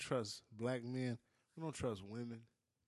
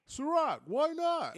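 hammed-up pitchman voice; 12 semitones higher, 6 dB louder, slower than usual. Joking about [name] promoting his brand.

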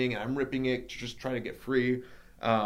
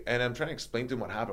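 ordinary talk, nothing marked

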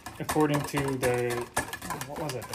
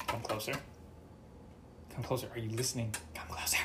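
softer, lowered secret voice; conspiratorial hush; 4 semitones lower, 11 dB quieter, faster than usual. Shown as a demonstration. Leaning in to tell the audience a secret, hushed. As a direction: draw the listeners in with a hushed confession.

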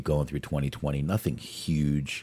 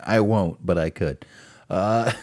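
ordinary talk, nothing marked

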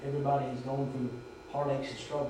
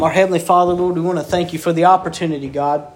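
loud, fervent preaching prayer; 14 dB louder than usual. Real, not acted. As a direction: pray out loud with full force. Opens prayer at full force.

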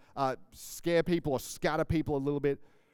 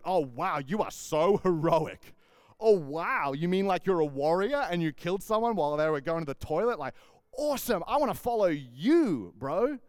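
mock admiration; 3 dB louder than usual. Preacher mocks followers praising a cowardly king, sarcastic awe. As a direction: gush over him with fake awe. Imagined followers mocking David's story.

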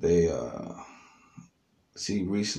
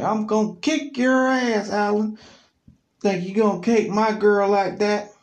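much higher, loud mock threat; threatening ex-boyfriend; 9 dB louder than usual. Ex-boyfriend threatening Alan at the door, loud high mock threat. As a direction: bark it like an angry junkie.

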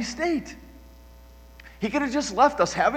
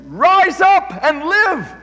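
commanding call, higher, louder and slow; voice of God; 8 semitones higher, 9 dB louder, much slower than usual. Acted. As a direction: proclaim it like God raising the dead. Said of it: "God has said".

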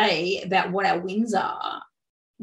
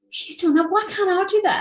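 higher and louder, jealous complaint; envious whine; 12 semitones higher, 4 dB louder than usual. Retold or imitated. Seeing others' sales online, jealous whine. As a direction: whine it out resentfully. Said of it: "And I'm like".

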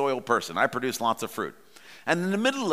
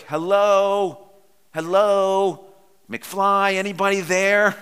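loud, higher "hello, hello, McFly" knocking on his head; mock loud wake-up call; 5 semitones higher, 7 dB louder, slower than usual. The Lord teasing the distracted pastor, loud mock call. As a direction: call out to snap a daydreamer back, hammed-up.

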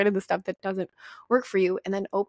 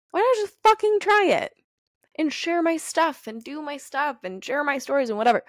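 much higher and louder, bursting out; excited outburst; 7 semitones higher, 3 dB louder than usual. Retold or imitated. To herself, finally daring to share, excited outburst. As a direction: fling the question out like a dare.

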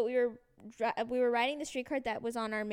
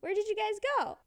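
high-pitched imitated mother; falsely innocent retold mom; prying mom; 10 semitones higher than usual. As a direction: question her in mom's pitched-up voice. Imitates mom questioning her.

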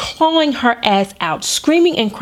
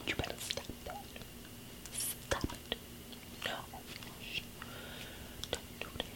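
put-on sneaky whispered threat to a tantrum kid; threatening whisper; 29 dB quieter, faster than usual. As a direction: whisper the threat so mom can't hear. Threatening a stranger's kid quietly.

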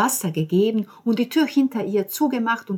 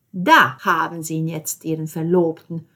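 louder, disapproving landlady; 7 dB louder than usual. Acted. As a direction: hand him over with disapproval. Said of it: "sagte die Signora missbilligend".

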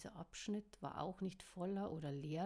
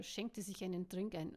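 ordinary delivery

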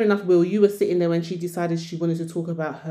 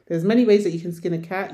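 ordinary talk

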